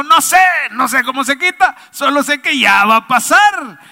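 high, loud exasperated outburst; desperate father. Acted. Preacher as father answering his wailing son, desperate. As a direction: cry out at wit's end. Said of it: "el papá desesperado le dijo".

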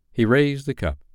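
plain reading voice

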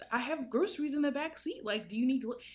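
high-pitched, exasperated retort; retold frustration. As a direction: push back, fed up with pointless questions. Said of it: "I'm really just frustrated".

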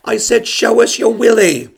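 put-on loud high childish whisper-shout; little girl. Little girl repeating her heckle into the mic, high and loud. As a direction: blurt it like a proud kid. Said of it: "She says".

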